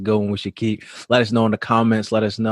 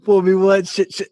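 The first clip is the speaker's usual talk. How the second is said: halting, higher-pitched quote with a stammer; George Bush; 6 semitones higher than usual. Retold or imitated. Co-host quoting a president's gaffe to his friend, halting impression. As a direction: stumble over the famous saying, amused. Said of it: "gotta sound like George Bush".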